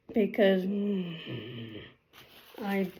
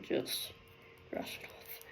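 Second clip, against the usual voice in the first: whispered to herself; breathy under-breath aside; 20 dB quieter than usual. Real, not acted. Muttered aside while working.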